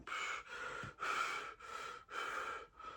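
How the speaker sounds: long, soft heaving exhale; imitated weary sigh. Showing how he came into the room, long sigh. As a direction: let out a long breath like someone fed up. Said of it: "the way that he came in the room was like".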